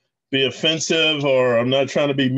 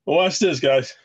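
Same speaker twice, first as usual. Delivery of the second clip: ordinary delivery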